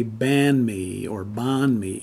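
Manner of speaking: ban mi or bon mi, plain a, then open o; mistaken vowels. Shown as a demonstration. Teacher showing what learners shouldn't say, wrong vowels. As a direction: say ban mi, bon mi slowly, without the i glide. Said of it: "you won't say".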